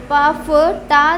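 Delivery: each syllable spaced out slowly; slow sound demo. Shown as a demonstration. Teacher sounding out पा, फ, ता for students, slowly. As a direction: pronounce पा, फ, ता one by one, slowly. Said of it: "के, P".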